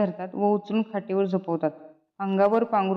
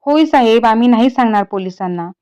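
loud pleading; 11 dB louder than usual. Acted. Shastri pleads with the thief.

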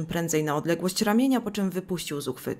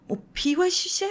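much higher, piercing horrified shriek; shrill scream; 11 semitones higher than usual. Acted. Mother Ida shrieks at her drunk son in horror. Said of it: "krzyknęła przeraźliwie Ida".

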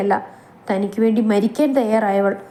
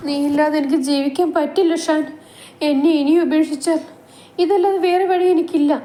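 pleading; 6 semitones higher than usual. Acted. Naishu pleading with Shan.

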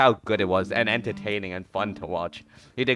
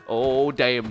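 normal talking voice

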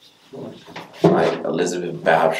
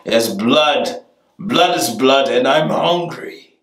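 hungry vampire; 6 semitones higher, 4 dB louder, slower than usual. Acted.

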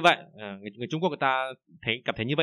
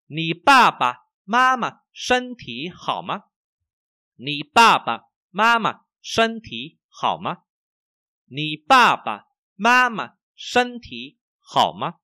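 high, slow, emphatic syllables; language teacher model reading; 4 semitones higher, 6 dB louder, slower than usual. Shown as a demonstration. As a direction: model each word for repeating. Models sentence for learners. (đọc mẫu chậm, nhấn rõ thanh điệu)

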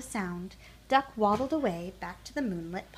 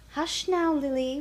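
plain reading voice

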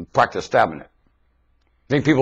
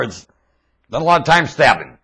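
normal talking voice

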